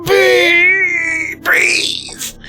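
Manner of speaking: very high, long-held mock squeal; strained squeal. Patient repeating the allergy to baffled doctor, drawn-out high squeal. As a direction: stretch it out in a tiny squeezed voice.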